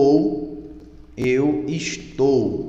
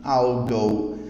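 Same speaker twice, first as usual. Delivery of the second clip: slow model line; 3 semitones lower, much slower than usual. Shown as a demonstration. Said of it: "primeira frase".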